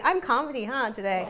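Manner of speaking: ordinary delivery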